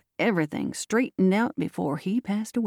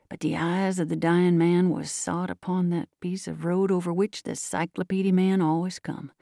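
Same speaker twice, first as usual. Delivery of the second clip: plain reading voice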